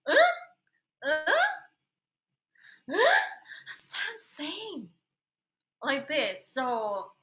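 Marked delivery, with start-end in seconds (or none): 0.1-3.2 rising, increasingly baffled huhs; can't hear the difference, getting more lost. Retold or imitated. Singer to her vocal coach, baffled rising huhs. Retold confusion at pronunciation drill. 3.7-4.9 breathy whisper, higher; whispered aside. Retold or imitated. Singer whispering to herself about her coach's sounds, baffled. Retold confusion at pronunciation drill.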